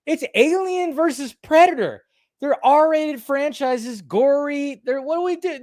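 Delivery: high-pitched outraged excitement; incredulous rant. Retold or imitated. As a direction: rant in disbelief at the rating. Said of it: "I remember just going like".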